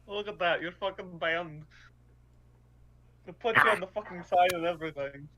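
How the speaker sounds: loud, acted-out bird's reaction; mock excited outburst. Player voicing Nat seeing the banned notice, mock excited outburst. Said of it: "it's like".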